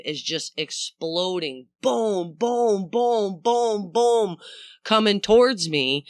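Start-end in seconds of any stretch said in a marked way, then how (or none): 1.8-4.4 booming waves. Retold or imitated. Imitating waves exploding on pier.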